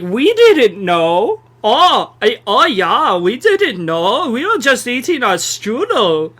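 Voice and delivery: mock German accent, stretched vowels and swooping tune; camp, drawn-out German accent. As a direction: play a zesty German pleading innocence. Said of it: "That's my German accent".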